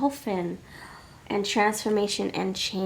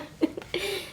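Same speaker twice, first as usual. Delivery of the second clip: high breathy giggle; 11 semitones higher, 4 dB quieter than usual. Real, not acted. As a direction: let out a quick giggle. Laugh attack.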